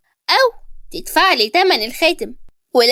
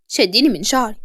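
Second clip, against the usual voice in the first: ordinary delivery, nothing marked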